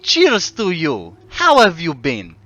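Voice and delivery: put-on Russian accent, hearty toast. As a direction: greet a friend in mock Russian. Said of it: "say this word in a Russian accent".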